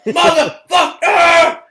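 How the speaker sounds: high, loud, slow drawn-out yell; imitated shouted exclamation. Cal voicing the fan's sign-off, loud drawn yell. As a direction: bellow it like the fan would.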